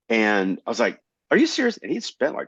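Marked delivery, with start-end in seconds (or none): 1.3-1.8 high, loud incredulous question; astonished at an expensive gift; stunned disbelief. Retold or imitated. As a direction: squeal it in disbelief.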